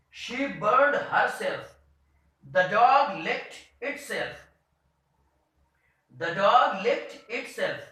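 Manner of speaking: each sentence slow and deliberate; slow example reading. Shown as a demonstration. Teacher reading example sentences aloud to students, slow and deliberate. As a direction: read every example slowly for the class. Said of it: "I will read the, uh, these, you can say, reflexive pronouns first".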